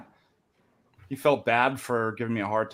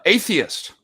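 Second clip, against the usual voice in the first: high, louder one-word answer; joke character's reply; 9 semitones higher, 5 dB louder than usual. Acted. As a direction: blurt it as the cornered man in a joke. Cornered man's answer in joke.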